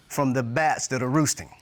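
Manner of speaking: normal talking voice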